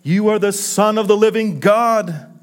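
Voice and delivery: rising, ringing confession of faith; bold, high proclamation; eloquent declaration. Acted. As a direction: proclaim it with conviction, pitch climbing. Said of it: "so eloquently declared to Jesus".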